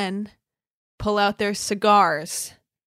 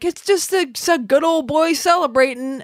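higher, louder hammed-up voice; mock good old boy; 7 semitones higher, 6 dB louder than usual. Mocking cigar smokers, a higher hammed-up good old boy voice. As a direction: ham up a proud cigar-smoking dude. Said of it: "people are like".

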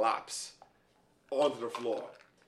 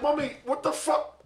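pleading whine, pitched way up; high, desperate son's plea; high whiny plea; 10 semitones higher than usual. Retold or imitated. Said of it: "I was like".

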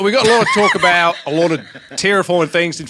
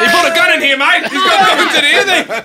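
shout; 11 semitones higher, 5 dB louder than usual. Acted.

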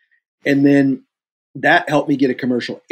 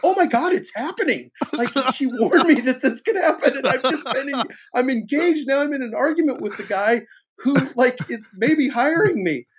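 high-pitched comic dread; hammed-up, squealing alarm at what's unfolding; retold panic; 13 semitones higher than usual. Actor arguing with man maybe hiring him, comic panic.

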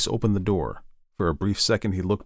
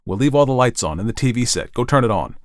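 louder, higher, pressing instructions; urgent Ed; 4 semitones higher, 6 dB louder than usual. Acted. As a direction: order her briskly, in haste.

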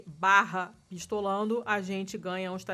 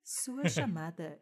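customer service voice; 3 dB quieter than usual. Pretend. Mock phone queue recording.

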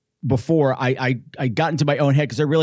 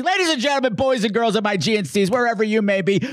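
announcer introduction; 6 semitones higher than usual. Acted. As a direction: present the guests with a stretched, rising flourish. Showy introduction of the guests.

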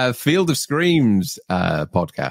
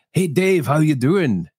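higher-pitched Scottish greeting, retold; mock Scottish accent; 5 semitones higher than usual. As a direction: imitate a Scot calling to a friend. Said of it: "he wasn't Scottish. Barry's Scottish".

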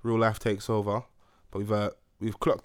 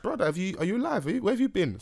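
raised pitch, amazed at a long silence; mock disbelief; 8 semitones higher than usual. Mocking surprise at a friend's first message since July. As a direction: act shocked he's alive. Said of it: "I'm like".

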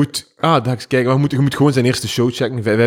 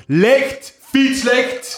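high yelled Licht! Fietslicht!; shouting at cyclists; 11 semitones higher, much slower than usual. Retold or imitated. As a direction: call out the window at a dark cyclist. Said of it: "ik roep naar mensen". (hoog roepen naar fietsers)

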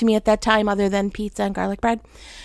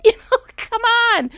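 laughing; 12 semitones higher, 3 dB louder than usual. Real, not acted. Laughing at the absurdity.

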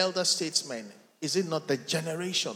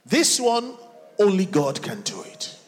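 loud, emphatic declaration to God; impassioned preaching prayer; 3 semitones higher, 6 dB louder than usual. Real, not acted. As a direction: proclaim it forcefully as a prayer. Preaching prayer at full force.

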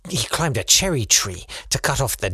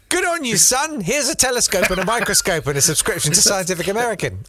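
high, hammed-up proud father; encouraging dad; 8 semitones higher than usual. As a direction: burst into exaggerated fatherly enthusiasm. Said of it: "No, he was like".

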